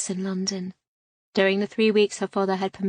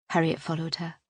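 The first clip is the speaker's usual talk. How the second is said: ordinary delivery, nothing marked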